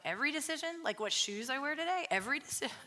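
half-joking disbelief at her own conviction; mock disbelief. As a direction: act shocked that it means every single choice. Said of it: "I'm like".

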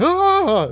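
high, loud "ah" scream; re-create a jump-scare scream; sudden high-pitched yelp; reenacted scream. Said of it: "I actually screamed from it. I went".

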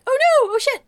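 high, loud panicked yelp; mock panic. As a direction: cry out in hammed-up alarm. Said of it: "So she's like".

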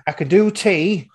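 lightly voiced barmaid offering tea; barmaid's line. Barmaid offers customers tea, lightly imitated. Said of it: "She's like".

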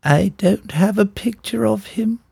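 high, put-on film-character voice; acted film quote. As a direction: play the movie line in a slow, high voice. Acting out a film quote for quiz.

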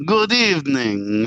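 put-on hammy, slow Transylvanian accent, high; overdone Transylvanian accent, drawn out. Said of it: "No, not like that. That's tacky".

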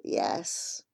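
breathy whispered yes; shy whisper. A narrator's reading. Shy Jivan answering Asha in a whisper. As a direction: answer barely aloud, shy. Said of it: "he replies shyly".

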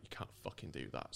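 hushed retold owner. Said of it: "the owner's like".

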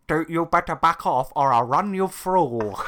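slow, laughing nerdy threat; fake-tough nerd threat. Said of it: "in the most nerdy voice I've ever heard".

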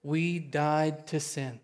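"We died to sin" said very slowly, each word weighted; slow emphasis. Shown as a demonstration. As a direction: let every word land. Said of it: "The first thing verse two says".

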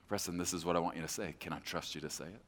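low, soft voice of patient guidance; Holy Spirit. Acted. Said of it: "here's how I know it's the Holy Spirit".

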